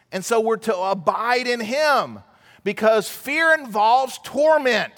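usual speaking voice

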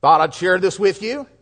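normal talking voice

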